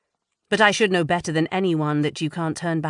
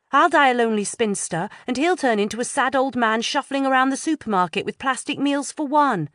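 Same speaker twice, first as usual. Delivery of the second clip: put-on higher, louder girlish voices; raised young voices in dialogue; teen girls' dialogue; 8 semitones higher, 3 dB louder than usual. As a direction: act out two teen friends chatting.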